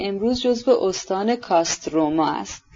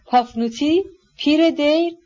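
higher-pitched, questioning call after someone; anxious calling out; 7 semitones higher than usual. A narrator's reading. Wife calling after her hurrying husband, mildly anxious calling out. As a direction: call it out as a worried, peevish question. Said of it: "با نگرانی بسیار ... فریاد زد".

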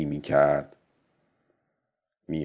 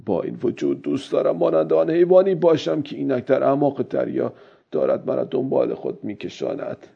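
higher-voiced musing that fades; old fisherman reflecting to himself; 6 semitones higher than usual. A narrator's reading. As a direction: reflect as the old man, pitched up, then let it drop away.

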